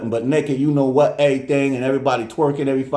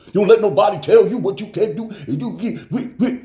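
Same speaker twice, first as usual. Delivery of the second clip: louder, higher preaching uncle voice; Uncle Mo pep talk; 8 semitones higher, 3 dB louder than usual. Retold or imitated. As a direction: hype her up like a fired-up uncle. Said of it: "I got an Uncle Mo".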